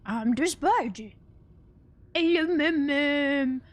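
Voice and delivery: slow, drawn-out dog voice; pet dog voice. Retold or imitated. Imitating Martina voicing her dog, slow silly pet voice. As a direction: imitate a YouTuber's doting dog voice. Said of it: "Martina, she would always go".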